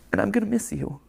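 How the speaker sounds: emotional, close to tears; holding back tears, words catching; choked up, voice barely holding. Real, not acted. Creator saying goodbye to viewers, choking up. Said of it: "physically cry".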